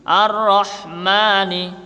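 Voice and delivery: Ar-Rahmani with pressed rr and ma held two beats; long ma. Shown as a demonstration. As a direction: stretch the ma in Ar-Rahmani. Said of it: "ma-nya mat tabi'i".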